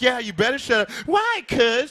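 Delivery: high, heated mock threat; mock quarrel. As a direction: raise the pitch as a hammed-up squabble builds. Acted quarrel with the girl.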